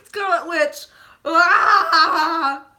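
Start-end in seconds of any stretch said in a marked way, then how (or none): 1.2-2.7 long, very loud mock wail; demonstrated wailing. Shown as a demonstration. Showing her crying level for Scarlet Witch, wailing. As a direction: bawl it out, hammed-up. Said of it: "my crying level. I'll show you".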